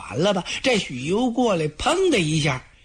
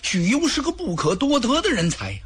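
语速加快的训斥，略微拔高; 一股急切的责备，微带怒气; scolding anger; 5 semitones higher than usual. Acted. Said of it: "曹操一看可生了气了". (曹操责骂许褚鲁莽，语速加快)

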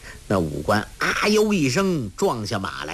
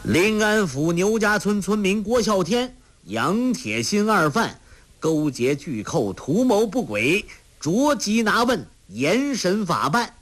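higher, formal proclamation; reading aloud loudly; 8 semitones higher than usual. Acted. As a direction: read the warrant aloud. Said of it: "大声读道".

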